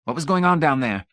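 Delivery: high, loud demand; demanding question. A narrator's reading. Laws asks what happened in the basement, demanding. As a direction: press hard for an answer. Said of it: "Laws demanded when he could talk".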